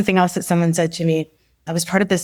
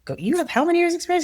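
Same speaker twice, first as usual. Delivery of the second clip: high, quick pep talk; insistent encouragement, pitched well up; imitated urging; 11 semitones higher, 3 dB quieter, faster than usual. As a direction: imitate a coach pushing someone on. Retells coach urging her on.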